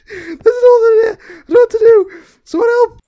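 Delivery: very high, loud helpless cry; panicked wailing. Real, not acted. Player lost and chased, wailing for help. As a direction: wail for someone to save you.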